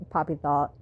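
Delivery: usual speaking voice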